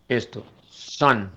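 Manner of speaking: sun with a short clipped uh vowel. Shown as a demonstration. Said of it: "Yo no digo sun porque es una A".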